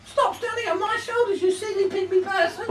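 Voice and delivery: high squeaky shouted voice; shrill, fast comic squeal; pygmy dressed as giant. Acted. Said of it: "they should sound like".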